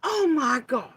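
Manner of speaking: high, loud startled cry; startled oh my gosh. Real, not acted. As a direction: blurt it out in shock.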